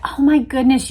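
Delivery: ordinary talk, nothing marked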